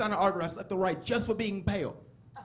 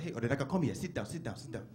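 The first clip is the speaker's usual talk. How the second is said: softer, lower fatherly summons; Nigerian dad; 5 semitones lower, 7 dB quieter than usual. Retold or imitated. Dad sitting his son down for a talk, imitated accent. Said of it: "like my dad".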